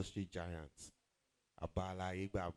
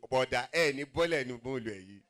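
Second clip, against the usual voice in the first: high, loud hammed-up begging; mock pleading shout; 5 semitones higher, 12 dB louder than usual. MC acting out begging a rich man on the bus, loud and high. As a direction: plead loudly like clinging to his hem.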